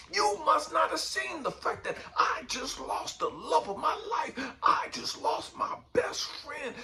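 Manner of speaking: furious heartbroken cry at God; raging at God. Retold or imitated. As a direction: yell your grief at the sky. Retelling his rage at God.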